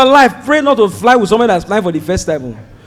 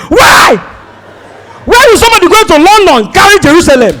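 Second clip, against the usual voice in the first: indignant yell; 8 semitones higher, 4 dB louder than usual. Retold or imitated. Indignant protest.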